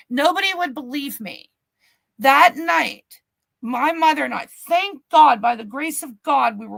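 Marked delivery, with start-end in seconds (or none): none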